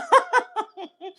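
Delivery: bright high laugh; sudden squeal of a laugh; high burst of laughter. Real, not acted. Laughing at her own story.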